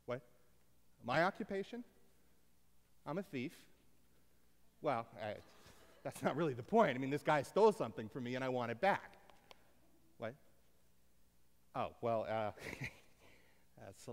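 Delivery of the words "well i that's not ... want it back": normal talking voice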